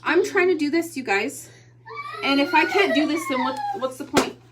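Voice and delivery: loud high plea on the edge of tears; tearful pleading. Real, not acted. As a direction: beg them to stop, voice cracking. Mother pleading, near tears.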